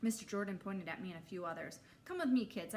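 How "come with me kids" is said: put-on louder call to the kids. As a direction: call them over. Counselor calling kids over.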